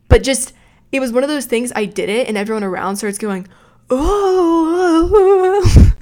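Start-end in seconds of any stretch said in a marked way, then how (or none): 3.9-5.9 rising mock oohs, louder and higher; hammed-up shocked crowd; crowd gasp. Crowd reacting to water pour.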